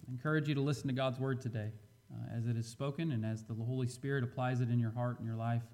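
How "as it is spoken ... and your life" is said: ordinary talk, nothing marked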